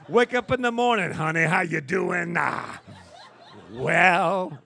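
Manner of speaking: loud, breathy pulpit voice; wind-sucking preacher. Retold or imitated. Preacher at home greeting honey, hammed-up pulpit voice. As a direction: greet your wife like a sermon. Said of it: "wind-sucking preachers".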